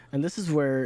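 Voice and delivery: usual speaking voice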